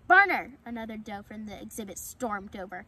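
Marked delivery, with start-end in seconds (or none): 0.1-0.6 sharp loud call of a name; shout. Acted. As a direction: yell the name to stop him. Doe yells at fawn.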